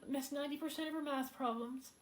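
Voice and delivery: fighting back tears over her mother's decline; emotional. Real, not acted. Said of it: "I'm a little bit emotional about it".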